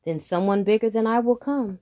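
threatening. Acted. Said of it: "said the heifer".

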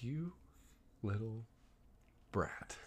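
very soft, halting, under the breath; muttered disapproval. Retold or imitated. Teller reacting to bratty cousin, muttered disapproval. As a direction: mutter it with long pauses between words.

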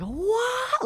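sudden high squeaky "what"; flipping out. Retold or imitated. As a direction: jump way up in pitch, startled. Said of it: "just flip out".

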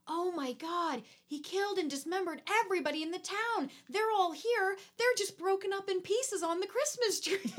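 very high, hammed-up hysteria; mock horrified panic. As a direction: act out a horrified discovery, pitch climbing. Acts out horrified survivors' discovery.

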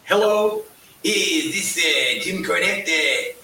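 halting old-man impression, drawn-out words; hesitant, slow phone greeting in character; put-on voice, fumbling through the question; Stu Hart. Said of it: "everybody did Stu Hart".

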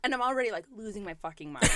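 usual speaking voice

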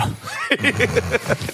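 high burst of laughter. Real, not acted. Friends laughing at the piss shots line. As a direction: lose it at the punchline.

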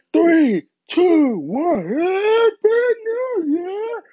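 high, loud, stretched-out crying cheer; mock party countdown. Partier with a paralyzed face crying out the countdown, high and loud. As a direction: wail the countdown like a wrecked partier.

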